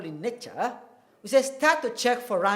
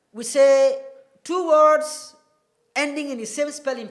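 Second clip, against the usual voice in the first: emphatic teacher; 6 semitones higher, 6 dB louder than usual. Shown as a demonstration. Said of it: "Areas to note".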